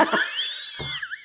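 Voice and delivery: high, shrieking laugh; squealing laugh. Real, not acted. Host squealing with laughter at her own line. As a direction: let a shrill squeal of laughter out.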